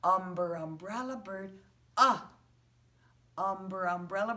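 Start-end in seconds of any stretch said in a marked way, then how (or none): 0.0-2.2 teacher modeling the "uh" sound; short u sound. Shown as a demonstration. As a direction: stretch the "uh" in "Umber, umbrella bird, uh". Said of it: "So we say".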